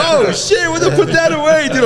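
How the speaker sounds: very high, squeaky mock disgust; mocking high guys' voices. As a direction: squeal it like shocked teenage boys. Guys react to shirt joke.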